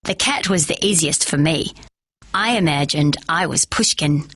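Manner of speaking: ordinary delivery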